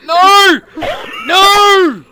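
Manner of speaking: screaming no in despair; anguished shout. Real, not acted. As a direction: howl it out. Losing the race, anguished.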